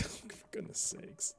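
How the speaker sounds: whispered exasperation. Real, not acted. Exasperated at puzzle naming.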